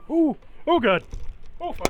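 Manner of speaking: sudden loud, high fright; real startled yelp. Real, not acted. GM spots a wasp, startled high yelp. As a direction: jump at the wasp in real alarm.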